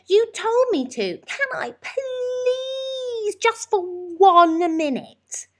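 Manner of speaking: very high, slow, near-tearful pleading; whining child. A narrator's reading. Lester begging his mum to let him play, high and tearful. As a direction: beg with a wobbling cry.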